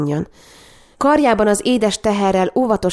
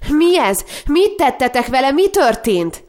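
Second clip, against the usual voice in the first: tearful cry; 7 semitones higher than usual. A narrator's reading. Sister cries out in tears at her brother. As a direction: cry out in tears over the sick baby. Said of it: "Már potyogó könnyeken keresztül kiáltotta".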